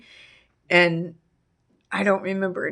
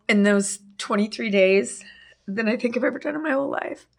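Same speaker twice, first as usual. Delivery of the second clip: usual speaking voice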